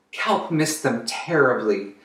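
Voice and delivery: usual speaking voice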